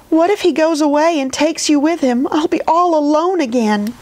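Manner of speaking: high plaintive worry, understated; a touch of wailing, held high; mild wail. A narrator's reading. Edith dreads being left all alone, mildly wailing. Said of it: "Edith wailed".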